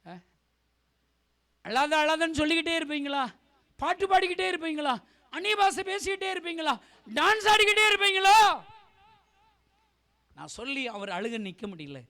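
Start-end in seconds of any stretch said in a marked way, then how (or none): 1.6-8.4 loud, high questions climbing to a shout; scolding. Real, not acted. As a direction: hammer each accusing question harder than the last. Rebukes congregation in repeated questions. (அடுக்கடுக்காய் உரக்கக் கண்டித்து)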